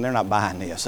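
muttered aside. Retold or imitated. Child's inner thought.

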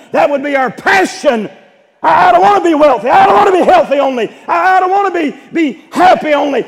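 loud, high impassioned preaching; full-force preaching. Real, not acted. Baptist preacher exhorting his congregation, loud impassioned preaching. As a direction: preach it with all your fire.

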